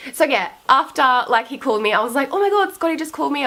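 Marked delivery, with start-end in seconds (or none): 2.3-3.5 fast, high giddy gush; breathless excited fangirl rush. Retold or imitated. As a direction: gush like a starstruck fan. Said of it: "I was, like, fangirling".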